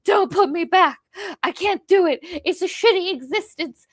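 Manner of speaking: high-pitched, panicked acted begging; horrified plea. Prisoner in the sapper begging Vis, horrified plea. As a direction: plead in mock horror, pitch jumping up. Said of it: "it's supposed to be, like, this horror".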